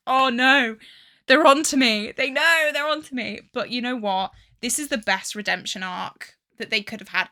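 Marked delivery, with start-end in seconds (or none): 0.1-3.4 loud mock-alarmed wail; mock panic. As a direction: ham up the dread of being caught. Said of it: "I was like".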